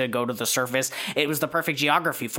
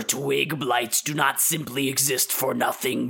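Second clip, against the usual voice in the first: ordinary talk, nothing marked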